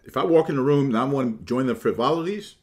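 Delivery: normal talking voice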